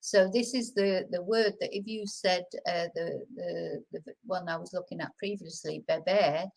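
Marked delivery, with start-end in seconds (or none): none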